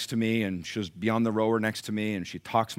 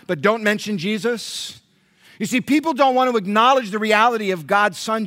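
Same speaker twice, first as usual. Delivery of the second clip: loud, higher-pitched preaching with driven questions; full-force preaching; 11 semitones higher, 9 dB louder than usual. Acted. Pastor challenging church about being known as Christians, forceful preaching. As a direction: preach it hard to the congregation.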